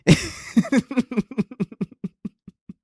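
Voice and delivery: high burst of real laughter; cracking up mid-rant; big laugh. Real, not acted. Streamer laughs at own joke.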